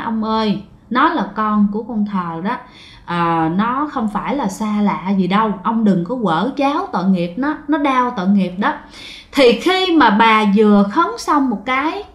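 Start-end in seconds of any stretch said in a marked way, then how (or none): 0.0-8.7 softer, low, reverent plea to the dead; murmured prayer. Retold or imitated. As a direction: pray quietly over the grave for the child. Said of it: "bà bắt đầu khấn vái". (giọng khấn vái nhỏ nhẹ, thành kính)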